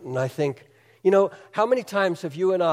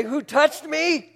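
incredulous disciples; 9 semitones higher, slower than usual. Acted. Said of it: "and yet you say".

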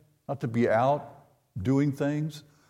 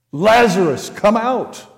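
loud, higher call into the tomb; Jesus calling Lazarus out loud; commanding call; 8 semitones higher, 8 dB louder than usual. Retold or imitated. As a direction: summon the dead man out.

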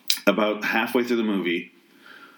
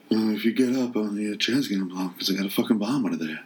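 hushed, lazy Southern-drawl impersonation; whispery southern drawl. Retold or imitated. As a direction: imitate an action star's whispery Southern drawl. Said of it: "he's got a weird southern drawl".

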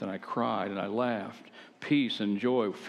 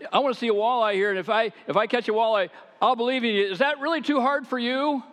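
raised, much higher voice daring God to deliver; loud challenge; 12 semitones higher, 5 dB louder, faster than usual. Retold or imitated. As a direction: call it out high and loud, half-challenging.

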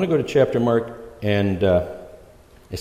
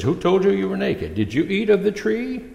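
God questioning; 9 semitones higher than usual. Retold or imitated. God questions Adam in retelling.

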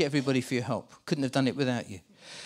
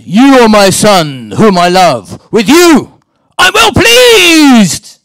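huge drawn-out shout from heaven, climbing higher; God's booming shout; 4 semitones higher, 22 dB louder, much slower than usual. Acted. Preacher playing God calls down from heaven, booming shout. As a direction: bellow each phrase slowly, very loud. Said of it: "I've got to be God here".

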